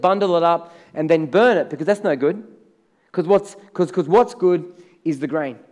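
ordinary talk, nothing marked

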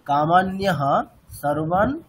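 কামান্যহা সর্বান spoken slowly, joined; slow recitation. Shown as a demonstration. Teacher modelling the joined phrase for students. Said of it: "ঠিক একইভাবে এখানেও তাই।".

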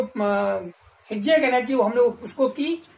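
usual speaking voice

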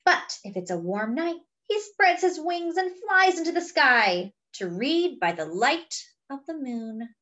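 ordinary delivery

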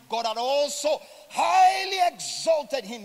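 loud, slow proclamation of the verse; proclaiming. Real, not acted. Preacher to congregation, loud slow proclamation. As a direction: ring out each word of scripture slowly.

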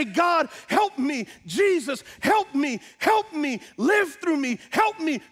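high, insistent preached plea; crying out to God. Acted. Believer on their knees begs God for help, crying out. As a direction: cry out in short, urgent appeals. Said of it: "you cry out and you say".

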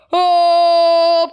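loud startled oh; surprised. Acted. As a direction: cry out in surprise.

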